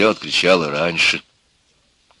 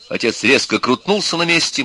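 ordinary delivery

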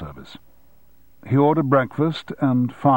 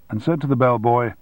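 ordinary delivery

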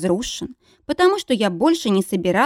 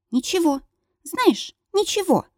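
breathless, panting pleading; out of breath; 9 semitones higher than usual. Acted. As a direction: gasp the words out between breaths. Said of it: "тяжело дыша".

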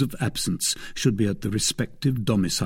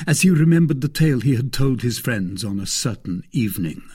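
ordinary delivery, nothing marked

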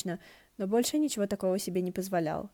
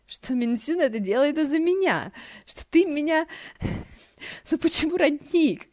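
Ши Цинсюань voice; 9 semitones higher, 7 dB louder than usual. Acted. Ши Цинсюань voiced, bright excited.

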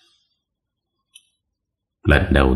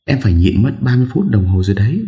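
put-on young woman voice; 6 semitones higher, faster than usual. A narrator's reading. Girl whines she needs to pee.

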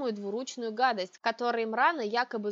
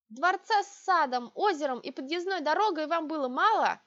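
indignant; 6 semitones higher, 4 dB louder than usual. A narrator's reading. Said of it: "возмущалась я".

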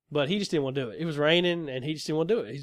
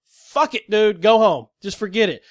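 loud, higher, fed up; angry dismissal; 5 semitones higher, 7 dB louder, slower than usual. Retold or imitated. Walking away from the driver, loud angry dismissal. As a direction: wave him off in anger.

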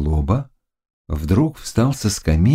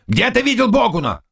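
raised, rough interrogation; barked demand; 13 semitones higher, faster than usual. Acted. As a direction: bark the question, pitched up. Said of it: "рявкнул Заглоба". (грозный, требовательный окрик)

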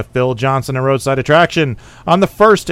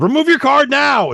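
mock shout; 10 semitones higher than usual. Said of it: "uh, you know".

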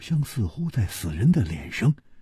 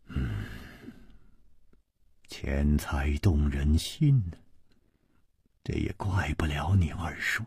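quiet resignation; 7 semitones lower, 8 dB quieter than usual. A narrator's reading. Chu excusing the uncle's greed to the girl, quietly.

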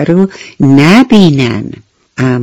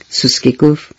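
plain reading voice

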